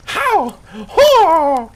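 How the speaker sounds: high, loud desperate shout; panicked player shouting at the game; desperate cries. Acted.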